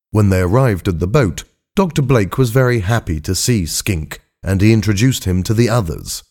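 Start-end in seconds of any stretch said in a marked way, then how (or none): none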